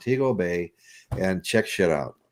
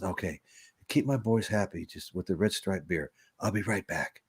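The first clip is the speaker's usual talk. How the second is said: leaning in; 3 semitones lower, 7 dB quieter than usual. Retold or imitated. Bartender leans in, confides.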